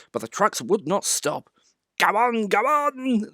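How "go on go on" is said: high, slow teasing chant; silly giggling. A narrator's reading. Trucks teasing Thomas, silly giggly taunt. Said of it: "they giggled in their silly way".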